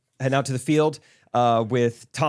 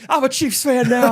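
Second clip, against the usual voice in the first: much higher and louder, gushing; mock convert cheer; 12 semitones higher, 6 dB louder than usual. Swick gushing that he's now a fan, squealing. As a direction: squeal it like a giddy new fan. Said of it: "He's like".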